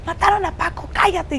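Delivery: high, loud panicked news; frantic blurt. Retold or imitated. Coordinator telling the staff Paco was killed, frantic loud blurt. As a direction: spit it out in alarm.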